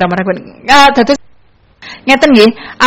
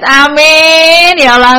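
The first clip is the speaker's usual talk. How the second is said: high, loud, bursting "Amin"; a joyful, loud amen; excited amen; 6 semitones higher, 6 dB louder, slower than usual. Real, not acted. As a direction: cry out "Amin" with delight. DJ cheers a listener's greeting.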